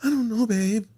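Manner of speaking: put-on high, evasive girlfriend voice; high mock-innocent woman; imitating woman friend. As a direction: brush it off in her high voice. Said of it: "What's the emergency?".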